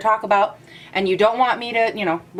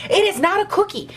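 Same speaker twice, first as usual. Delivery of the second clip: hammed-up indignation, pitched higher; mock-furious, higher repeat of the line; mock outrage; 10 semitones higher than usual. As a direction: push "it is not a cookie" up high in fake fury. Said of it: "that was really dramatic".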